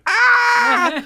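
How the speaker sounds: wild high scream; high terrified shriek. Retold or imitated. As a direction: let out a shocked scream. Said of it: "I scream".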